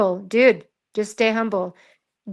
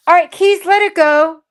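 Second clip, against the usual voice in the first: calling out; 6 semitones higher, 6 dB louder than usual. Real, not acted. Calls out to Keys.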